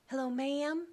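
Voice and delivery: put-on a caller from Alabama in an overdone Southern accent, pleading; terrible Southern accent. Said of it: "And terrible accent, I know".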